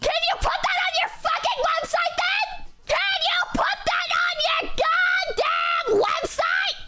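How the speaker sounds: high angry rant; pissed off. Real, not acted. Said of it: "kinda pissed off".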